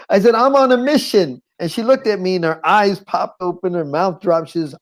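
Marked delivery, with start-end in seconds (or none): 0.3-1.4 high, emphatic retort; insistent declaration. Retold or imitated. Man refusing prostitute while searching for mother, high insistent declaration. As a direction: declare it urgently, pitched way up.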